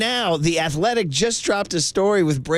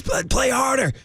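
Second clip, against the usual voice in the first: higher shouted coaching yell; yelling at kids; 7 semitones higher than usual. Retold or imitated. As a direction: bark it at the kids. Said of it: "As I, uh, I told the kids".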